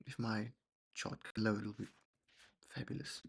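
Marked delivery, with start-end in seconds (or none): none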